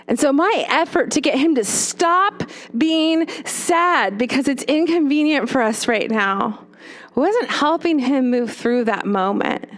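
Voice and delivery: ordinary talk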